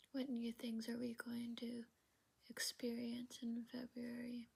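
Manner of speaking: hushed, breathy whisper of prayer; whispering a private prayer to God. Real, not acted. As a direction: pray it softly under your breath.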